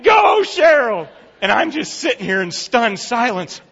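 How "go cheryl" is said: loud, high rallying chant; crowd cheering. Retold or imitated. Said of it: "People were clapping".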